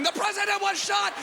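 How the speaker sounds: put-on high, loud panicked kid shout; running shout. Kid running past hedges, high panicked shouting. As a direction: yell it while running around. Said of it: "now they're running".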